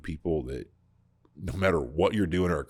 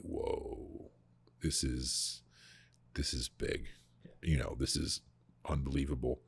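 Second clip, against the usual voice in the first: awed hush; 3 semitones lower, 10 dB quieter than usual. Retold or imitated. Awed onlookers at Lazarus raised.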